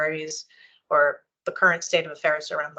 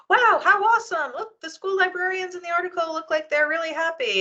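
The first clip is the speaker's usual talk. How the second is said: high, gushing excited voice; 11 semitones higher than usual. Retold or imitated. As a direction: read it like an overjoyed colleague. Mock colleague's gushing email reply.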